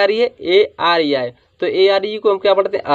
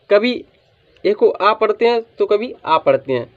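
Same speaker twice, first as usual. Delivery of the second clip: normal talking voice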